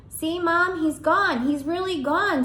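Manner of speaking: put-on high, loud insistent kid; boy exclaims up high that the hamster is gone; exclaiming boy. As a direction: insist loudly that he's gone. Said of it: "Simon exclaimed".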